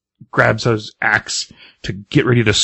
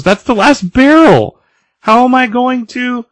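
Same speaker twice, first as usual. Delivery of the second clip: high, loud mock dismay; outraged shock; 7 dB louder than usual. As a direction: cry out over the lost barrel, hammed-up. Birka upset over broken mead barrel.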